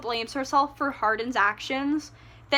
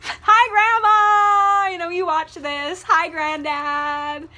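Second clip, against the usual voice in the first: slow, laughing, much louder and higher hello; laughing greeting; 5 semitones higher, 7 dB louder, slower than usual. Real, not acted. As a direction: call out to grandma through a laugh. Greeting grandparents who watch.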